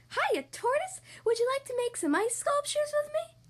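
very high-pitched excited rabbit voice; eager rabbit. A narrator's reading. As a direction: invite a friend in a high, squeaky-keen voice. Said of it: "asked Rabbit".

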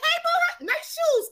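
high, sing-song flirty greeting; flirty call-out. Retold or imitated. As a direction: toss a compliment at a stranger. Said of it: "I don't give a".

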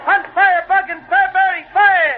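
hawking the extra edition at full voice; newsboy shouting headlines. Acted. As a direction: bellow the headlines to passing crowds. Newsboy hawking papers on street.

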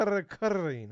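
"kurrin" with a trilled r, high; rolled r. Shown as a demonstration. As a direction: roll the r in kurr, kurrin. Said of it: "I can do the shit".

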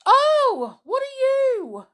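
high shrieking fright; frightened scream. Acted. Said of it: "she gave a scream".

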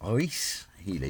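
put-on Reece Healy in a light French accent, slow. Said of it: "I want you to say that in a French accent".

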